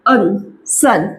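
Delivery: अन stretched very slowly, then सन; slow phonics blending. Shown as a demonstration. Teacher blending सन slowly for parents. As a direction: drag out अन before the word. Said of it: "जैसे कि".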